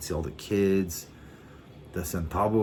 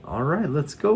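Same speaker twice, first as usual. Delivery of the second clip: higher, louder, suddenly keen; eager agreement; 9 semitones higher, 5 dB louder than usual. Retold or imitated. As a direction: jump at the invitation. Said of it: "And I was like".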